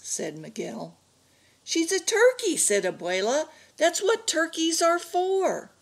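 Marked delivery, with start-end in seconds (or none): 1.6-2.6 high-pitched exasperated grandmother; insist, pitched way up; exasperated insistence. A narrator's reading. Grandmother argues over eating the turkey. 3.8-5.7 high, drawn-out exasperated grandmother; exasperated insistence. A narrator's reading. Grandmother argues over eating the turkey.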